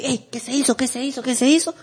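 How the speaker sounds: high-pitched, frantic repeated question; mock alarm. Captors discovering the prisoner is loose, mock alarm. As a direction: act out captors caught off guard, high. Acting out surprised captors in film.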